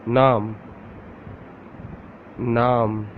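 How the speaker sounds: Naam with a long open "aa"; Urdu word. Shown as a demonstration. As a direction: model Naam to repeat. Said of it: "Repeat after me".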